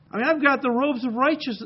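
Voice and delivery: ordinary talk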